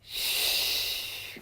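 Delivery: soft whispered shh of water spraying; spray sound. Shown as a demonstration. Mom shows the elephant spraying water, a soft shh. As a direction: hiss out the trunk's spray. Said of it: "spray it out".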